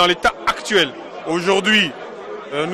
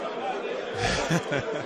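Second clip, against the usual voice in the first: soft laugh; 5 semitones lower, 13 dB quieter than usual. Real, not acted. Laughs after interviewer's jab.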